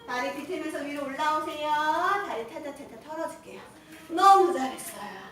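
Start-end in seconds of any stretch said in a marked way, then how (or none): 4.1-5.2 enthusiastic praise. Real, not acted. Coach praises class after set.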